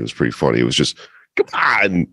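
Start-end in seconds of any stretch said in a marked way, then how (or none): 1.4-2.1 Walken impression. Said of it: "one-word Christopher Walken impression".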